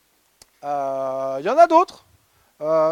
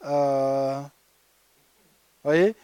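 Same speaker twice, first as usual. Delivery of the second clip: usual speaking voice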